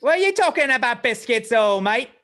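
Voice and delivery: hammed-up British accent, high and loud. Podcaster ribbing British phone autocorrect, loud mock British accent. Mimics a Briton after biscuit talk.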